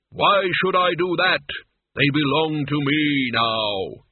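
Death, imperious and slow. Acted. Death refusing Mort's plea for the others, imperious. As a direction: draw it out as an unyielding Death.

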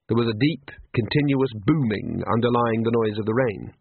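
ordinary delivery

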